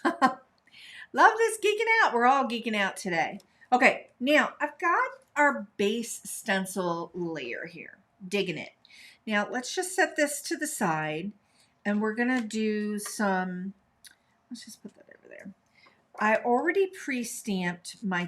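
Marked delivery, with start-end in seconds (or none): 0.0-2.1 high laugh slowing into the words. Real, not acted. Gleeful about crafting. 14.5-15.5 fast whispered aside to herself. Real, not acted. Aside while moving tools.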